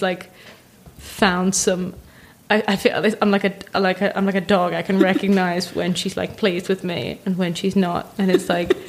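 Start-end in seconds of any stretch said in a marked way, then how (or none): none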